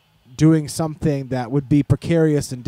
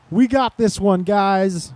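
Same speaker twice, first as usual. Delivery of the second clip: put-on Southern accent; 5 semitones higher, slower than usual. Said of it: "they're not from the South".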